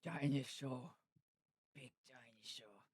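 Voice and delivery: put-on hushed whisper of a spellbound kid; awestruck child. Retelling being glued to the Godzilla film, awed whisper. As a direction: whisper it slowly, trailing into breath. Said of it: "I was just like".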